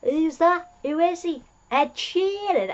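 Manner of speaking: lifted pitch, puzzled and curious; puzzled high voice. Retold or imitated. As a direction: ask it high, genuinely puzzled. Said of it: "the doctor thinks".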